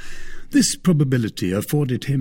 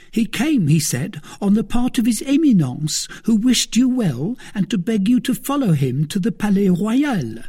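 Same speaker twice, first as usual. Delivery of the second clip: higher, louder anxious servant voice; a jittery valet blurting out news; frightened servant Planchet; 11 semitones higher, 4 dB louder than usual. A narrator's reading.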